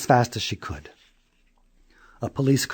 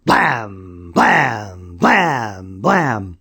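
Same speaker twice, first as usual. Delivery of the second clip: knocking sound; 3 semitones lower, 4 dB louder, much slower than usual. A narrator's reading. Knocking on door.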